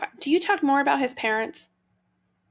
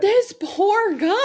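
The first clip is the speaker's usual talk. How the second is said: sympathetic; 10 semitones higher, 6 dB louder, slower than usual. Real, not acted. Reacting to victim's suffering.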